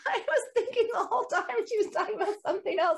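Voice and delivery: chuckling at own confusion; laughing. Real, not acted. Speaker laughs retelling the pissed mix-up, laughing.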